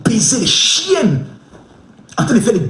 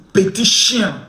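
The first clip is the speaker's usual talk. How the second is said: ordinary delivery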